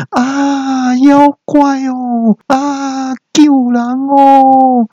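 very high, drawn-out comic scream. Acted. Woman seeing a talking dog, hammed-up screaming. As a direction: wail for help as if at a monster. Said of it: "大叫说".

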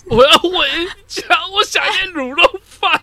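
high, slow wailing sob; mock crying. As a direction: whine it like a crying child.

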